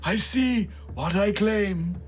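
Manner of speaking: high, fervent preacher voice; street preacher. Acted. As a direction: zealot greeting a convert. GM plays the preacher.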